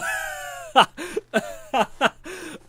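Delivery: long, high-pitched burst of laughter; real laughing fit. Real, not acted. Streamer cracking up at a test voice, laughing hard.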